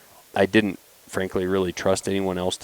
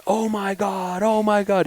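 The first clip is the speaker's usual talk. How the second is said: retold screaming; 12 semitones higher, slower than usual. Son just shot at a bear, imitated screaming. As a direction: imitate a boy freaking out, pitched way up. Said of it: "he starts screaming".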